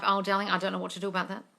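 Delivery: ordinary delivery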